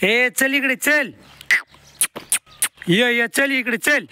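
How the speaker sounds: high, drawn-out herding call; slow calling. Real, not acted. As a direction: call the cattle over slowly and clearly. Calling animals to water.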